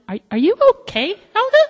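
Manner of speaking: high, hesitant, innocent question; feigned concern. Retold or imitated. Agent asking twitching supervisor, innocently concerned. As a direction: ask haltingly, rising to a squeak.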